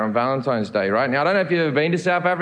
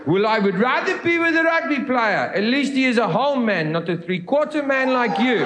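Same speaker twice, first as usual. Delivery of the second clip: scornful South African woman, pitched up; South African accent; 5 semitones higher than usual. Acted. Girlfriend prefers the rugby player, scornful South African accent. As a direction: throw the insult in a higher South African accent. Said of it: "I will now be doing a South African accent".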